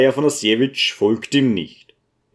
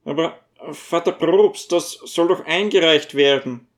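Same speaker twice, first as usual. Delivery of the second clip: higher dwarf voice, gently protesting; plead, pitched up and worried; dwarf protesting to the priest in dismay; 6 semitones higher than usual. A narrator's reading.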